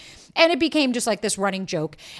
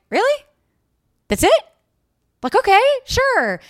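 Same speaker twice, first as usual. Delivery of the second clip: incredulous disappointment; 14 semitones higher, 7 dB louder than usual. Retold or imitated. After his flat good night, incredulous and deflated. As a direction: squeak out disbelief that the night is over.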